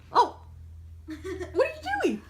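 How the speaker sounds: ordinary talk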